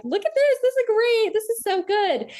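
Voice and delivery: high-pitched, gushing imitation of delighted photographers; excited photographers. Retelling photographers showing her the camera screen, excited. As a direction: show off the shot with excited praise.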